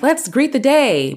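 hammed-up bright wake-up call; mock cheery. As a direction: greet the morning like an eager early riser. Cheery morning person quoted.

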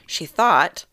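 normal talking voice

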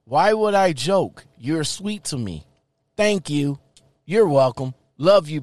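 ordinary talk

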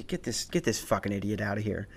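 dismissive guard. Retold or imitated. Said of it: "he's just like".

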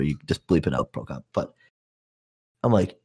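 usual speaking voice

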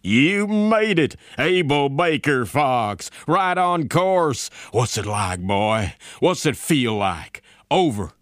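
drawn-out, high whoop easing into eager questions; elated, loud radio congratulations; jubilant general. A narrator's reading. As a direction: cheer the pilot over the radio, stretching the words. General jubilant as pilot checks in.

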